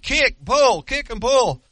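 high, loud swim instructions; shouted coaching. Acted. Little boy shouting swim strokes to his friend, loud and high. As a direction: call the strokes out across the water.

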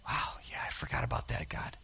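breathy whispered realization. Real, not acted. Preacher confessing to God, whispered. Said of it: "whispered realization".

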